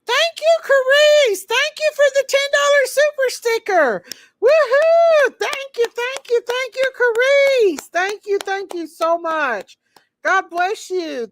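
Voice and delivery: very high, loud, squealing thank-yous; excited thanks. Real, not acted. Streamer squealing thanks to a donor for the super sticker, excited. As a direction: gush over the donation with big high cheers.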